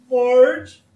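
high, loud, slow whine; Homer whining. Retold or imitated. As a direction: whine like a scared husband. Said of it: "walks in whining".

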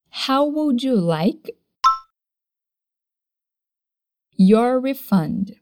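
slow, stretched out, stress on would; slow drill. Shown as a demonstration. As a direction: drill it slowly, word by word. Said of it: "One more time".

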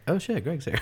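high, hushed mock surprise. Imagining opening the door to Greg, high and hushed. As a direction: gasp it in fake shock. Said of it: "be like".

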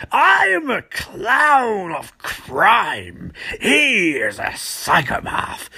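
very slow, put-on cackling villain; theatrical villain voice. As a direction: drag each word out like a grand cartoon villain. Trickster imitated in Hamill voice.

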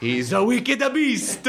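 high, slow, laughing scold; scolding teacher. Pretend. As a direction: sneer it out, laughing. Mock teacher's line repeated.

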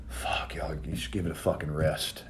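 imitating a muttered whisper of annoyance; under his breath. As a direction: grumble it to yourself so nobody hears. Muttering to himself about arguing.

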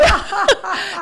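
short burst of laughter. Real, not acted. Laughing about absent guest.

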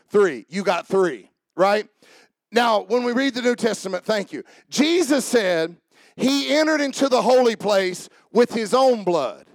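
usual speaking voice

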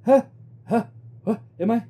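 high, loud, stunned and confused; dazed gunshot victim. Retold or imitated. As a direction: gasp it out like you just got shot. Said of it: "watching him just go like".

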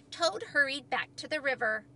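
usual speaking voice

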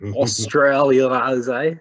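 slow, drawn-out bogan Aussie; bogan Australian accent. Pretend. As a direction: stretch it out in broad Australian. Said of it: "you're our token bogan Australian accent".

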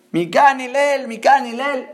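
acted shout to summon someone; calling out. Voz del señor que llama. (llamando a alguien en voz alta)

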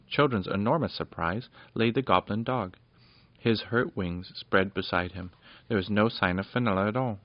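usual speaking voice

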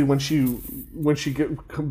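ordinary delivery

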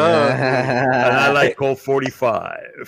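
slow, smooth pitchman voice; Lando Calrissian. Acted. Lando plugging his drink, slow character voice. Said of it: "my name is Lando Calrissian".

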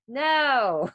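loud, high, drawn-out no; mock firm refusal. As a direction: act out a kid's emphatic veto. Quoting son switching off film.

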